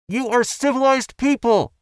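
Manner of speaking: high, loud indignant cry of a woman; muffled cry. A narrator's reading. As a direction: protest from inside the bundle. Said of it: "Mouse's muffled cry".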